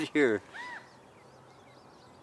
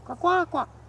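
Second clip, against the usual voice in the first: high quacking bird call; quail call imitation; 4 dB louder, slower than usual. Vlogger coaxing the hidden quail, high quacking call. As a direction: coax the bird with its own call.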